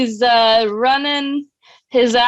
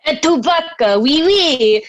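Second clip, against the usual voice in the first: higher, hammed-up French accent; mock French accent; 5 semitones higher, slower than usual. Messenger parodying Perdita's message, mock French accent. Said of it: "You don't have to do the accent".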